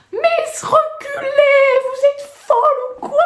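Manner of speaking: high, loud panicked yell; mock a stranger screaming a warning from afar; imitated warning shout. Passer-by warning the woman off the bin, high mock shout. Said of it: "il me crie".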